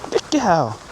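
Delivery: ordinary delivery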